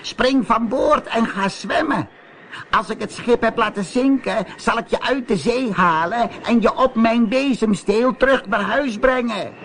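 high witch voice, surprised; sea witch calling. A narrator's reading. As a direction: ask it like a curious witch. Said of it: "riep de zeeheks".

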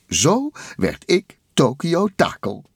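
high, grand declaration; proud Takel voice. A narrator's reading. Takel ending his tale, high and proud.

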